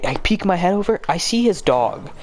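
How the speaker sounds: ordinary delivery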